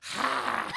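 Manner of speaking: loud hissy breath out; forceful exhale. Retold or imitated. Desiree unleashing pink toxin, loud forceful exhale. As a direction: imitate blowing toxin from the mouth.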